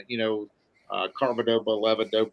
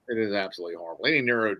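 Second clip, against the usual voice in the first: ordinary talk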